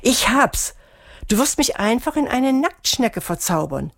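excited cry. A narrator's reading. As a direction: burst out with a sudden bright idea. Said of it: "schrie Melissa begeistert auf".

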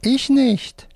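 very high, protesting puppet; left-hand puppet. A narrator's reading. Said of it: "Wolfram mit der linken Hand".

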